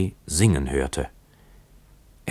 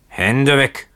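put-on higher, louder drunken bark; roared warning; 9 semitones higher, 5 dB louder than usual. A narrator's reading. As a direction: roar it as a sharp order to back off. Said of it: "brüllte der Bootsmann ihn an".

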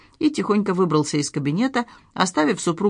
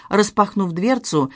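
ordinary delivery

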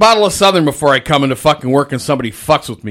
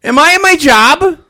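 incredulous retort; 14 semitones higher, 7 dB louder than usual. Retold or imitated. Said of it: "And I said".

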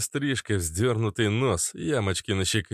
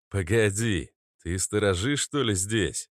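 ordinary delivery

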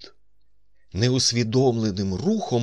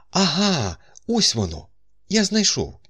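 high, louder burst of discovery; triumphant recollection; 11 semitones higher, 4 dB louder than usual. A narrator's reading. Doctor finding his story at last, excited relief. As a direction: exclaim as the memory suddenly lands.

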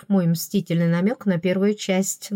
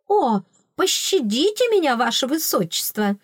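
higher, playful mock squeal; 6 semitones higher than usual. A narrator's reading. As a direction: squeal for mercy in jest. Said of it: "взвизгнула я". (шутливый визг)

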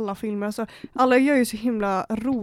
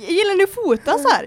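high, louder eager question; perky recruiter pitch; 9 semitones higher, 5 dB louder than usual. Retold or imitated. Recruiter asking girls about photography, perky and high. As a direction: pitch it to strangers, voice way up.

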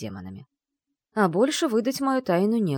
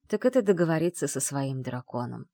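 ordinary delivery

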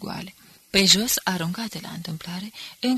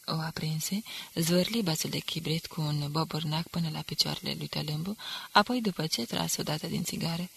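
plain reading voice

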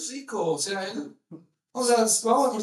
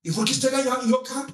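higher, fast protest; mock indignant surprise; 6 semitones higher, much faster than usual. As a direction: act put out and baffled, quickly. Rico sorprendido del cambio. (sorpresa indignada fingida)

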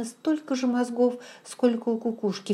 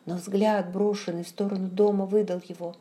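ordinary delivery, nothing marked

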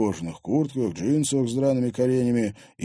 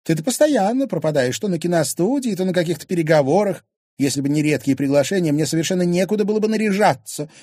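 higher, louder aggrieved wife; indignant wife; 9 semitones higher, 6 dB louder than usual. Acted. Wife complaining to husband about his absences, aggrieved. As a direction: complain loudly in a high wifely voice.

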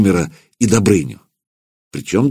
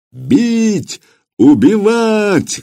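high, slow booming chant of a mob; thundering crowd roar; 13 semitones higher, much slower than usual. A narrator's reading. The assembly roars for blood, booming. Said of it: "гремело Вече".

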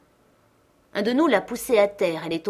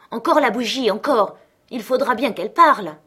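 angry demand; 3 semitones higher, 6 dB louder than usual. A narrator's reading. Angry friends demand the candle.

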